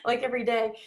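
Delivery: normal talking voice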